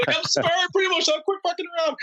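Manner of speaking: very high, squeaky put-on reply; mock apology. Student pilot answering his yelling mentor, high mock apology. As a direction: squeal it back as a joking apology.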